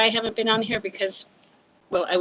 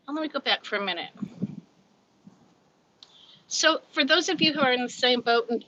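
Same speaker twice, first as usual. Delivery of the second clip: ordinary talk, nothing marked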